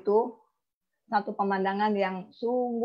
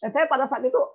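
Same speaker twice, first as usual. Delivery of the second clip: ordinary delivery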